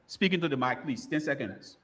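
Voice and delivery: usual speaking voice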